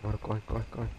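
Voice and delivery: soft, low frightened urging; hushed panic. Real, not acted. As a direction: keep it down, scared. Hiding from monster, hushed.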